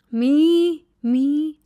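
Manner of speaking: loud, high mock of the driver; hammed-up loud imitation of the driver. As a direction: squawk his words back at him. Mimicking driver on phone.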